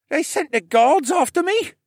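put-on high, loud panicked boy; alarmed cracking yelp. A narrator's reading. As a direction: squeak it out in dismay. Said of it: "Rylan's voice cracked".

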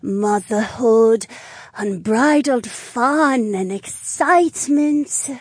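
giddy tipsy lizard mage; tipsy woman voice. Acted. Drunk Naomi voiced.

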